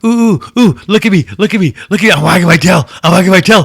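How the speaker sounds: high, loud, eager puppy yapping; dog begging its owner to look, excited and loud. Retold or imitated. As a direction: bounce up and down begging for attention. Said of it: "He's like".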